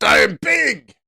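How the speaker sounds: high, shouted declaration; loud boast. Acted. Trader hyping himself up to trade big, loud boast.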